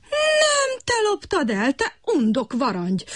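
high, slow screeching frog. A narrator's reading. Kutykurutyki screeches back at Brekeki, high frog voice. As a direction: shriek the accusation. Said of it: "sövítette Kutykurutyki".

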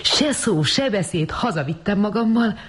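high-pitched, softened society anecdote; high, lilting boast to friends; telling amazed friends. A narrator's reading. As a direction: airily recount an adventure to admiring friends. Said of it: "utólag meséli majd ámuldozó barátainak".

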